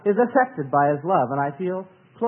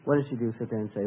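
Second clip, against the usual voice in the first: ordinary delivery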